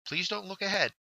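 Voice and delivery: ordinary delivery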